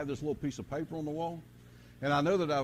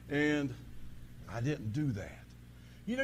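ordinary delivery

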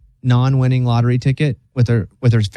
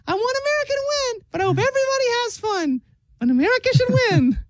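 Elmo voice; 4 dB quieter, faster than usual. Retold or imitated. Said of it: "Elmo did a video".

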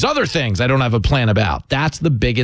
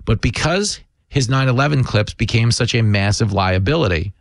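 normal talking voice

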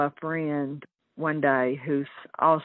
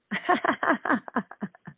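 laugh; 10 semitones higher than usual. Real, not acted. Laughs at own joke.

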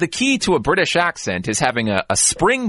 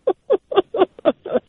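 high laugh. Real, not acted. Laughs at her own accent.

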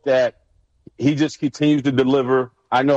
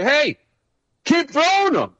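high-pitched mocking coach voice; mock the rookie in a squeaky coach impression; imitating coach B A; 12 semitones higher than usual. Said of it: "B A popped up and was like".

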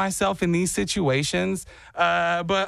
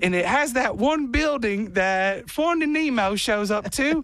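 higher, stretched mock Australian; fake Australian accent; 5 semitones higher than usual. Comic describes the film's building, higher fake Australian accent. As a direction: keep the bogus Aussie going. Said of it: "you can't stop".

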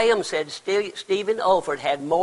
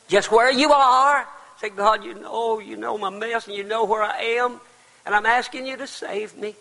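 higher and louder, pleading; fervent plea; 7 semitones higher, 3 dB louder than usual. Acted. Preacher modelling a sinner's prayer, fervent plea. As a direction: beg God from the heart.

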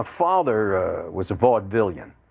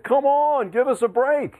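high, drawn-up sportscaster cry; retold catchphrase, exclaimed high; exasperated on-air protest; 14 semitones higher than usual. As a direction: complain to the ref on air. Retells his on-air catchphrase protest.

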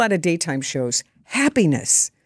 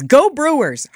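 imitated call to the crowd, higher and louder; retold rallying cheer; 6 semitones higher, 5 dB louder, slower than usual. Star leans into the mic for the crowd, retold rallying cheer. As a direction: call it out like a star hyping fans. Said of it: "leans in the mic and says".

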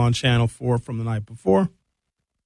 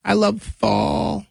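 lightly voiced, higher and slow gushing weatherman; a mild mock of the cheerful weatherman cooing about lattes; a light, slow, higher imitation of the weatherman's gush; imitating the weatherman; 6 semitones higher, slower than usual. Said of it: "he's always talking about".

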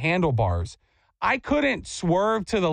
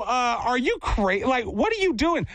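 incredulous retort; 6 semitones higher than usual. Retold or imitated. Retold retort to the lady.

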